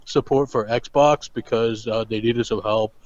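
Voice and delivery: lightly put-on lazy monotone; a hint of a deadpan vlogger; [name] impression. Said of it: "doing [name] the rest of the show".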